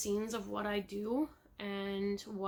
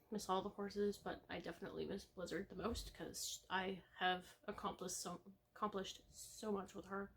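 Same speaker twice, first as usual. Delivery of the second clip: teary; 7 dB quieter than usual. Real, not acted. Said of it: "I'm crying 'cause sad story".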